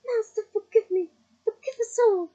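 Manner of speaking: very loud, high pleading; begging loudly, pitched high; desperate shriek. A narrator's reading. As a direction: scream for mercy at his master's feet. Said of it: "he shrieked".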